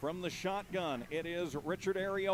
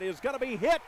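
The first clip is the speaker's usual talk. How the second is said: excited; 7 semitones higher, 6 dB louder than usual. Real, not acted. Sack call in play-by-play.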